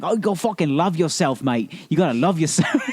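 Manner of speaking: high-pitched attempt at a big Australian bloke; brolic Australian. Host mocking show trainers urging self-love, hammed-up Australian. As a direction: put on a hammy Australian accent. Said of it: "big brolic Australian guys that are just like".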